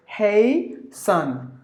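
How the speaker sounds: "hey son" slowly split, HAY... SUN; "hasten" sounded out as "hey son"; two separate syllables. Shown as a demonstration. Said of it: "you have to pronounce the word as".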